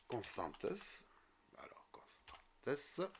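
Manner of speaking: slow, half-whispered mumble; whispered, drawn-out working aloud; muttering to self. Real, not acted. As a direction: sound it out softly to yourself. Muttering to self while typing.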